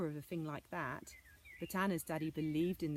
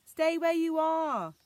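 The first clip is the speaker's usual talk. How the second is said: loud, slow call from afar; 10 semitones higher, 12 dB louder, slower than usual. Acted. Father calling to Anna up the hill, loud. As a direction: shout it up the hill, urgent. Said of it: "cried her father's voice".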